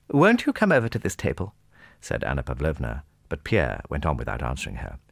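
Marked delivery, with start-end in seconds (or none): 0.1-1.5 high, coaxing lady's invitation; anxious hostess. A narrator's reading. Anna Pavlovna tries to draw Pierre away, high coaxing. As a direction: call him over politely, pitched high.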